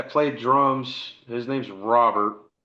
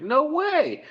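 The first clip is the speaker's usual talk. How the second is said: very high squeal of no way; stunned disbelief. Retold or imitated. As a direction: let it fly up in amazed disbelief. Disbelief at band news.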